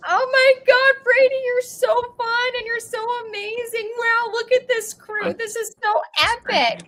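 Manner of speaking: high gushing excitement; joyful reunion. Real, not acted. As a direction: gush with high delight. Praising Brady and crew.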